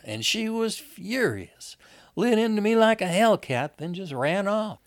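high, lively character voice, loud on the hellcat; indignant Wanda. Acted. Wanda tells her husband Lizzie ran off, high and indignant. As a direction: voice an aggrieved woman, pitched well up.

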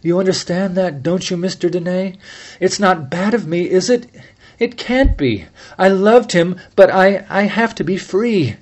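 pleading to be understood, voice climbing; distraught plea. A narrator's reading. Penny begs Fred not to judge her, distraught plea.

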